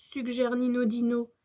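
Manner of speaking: plain reading voice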